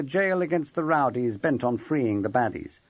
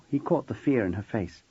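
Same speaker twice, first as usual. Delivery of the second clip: ordinary delivery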